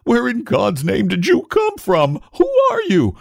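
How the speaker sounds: high-pitched frantic outburst. A narrator's reading. Father interrogates the old-man baby, frantically. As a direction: burst out, climbing to a squeal. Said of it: "burst out Mister Button frantically".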